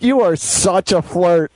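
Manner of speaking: slow, drawn-out teasing accusation; mock reproach. Husband voicing his wife's look at him, mock reproach. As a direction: imitate a wife's mock disapproval, stretched out. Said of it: "Charity's looking at me like".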